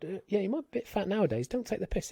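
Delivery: a hint of the brother's banter; lightly voiced brother. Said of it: "brother went".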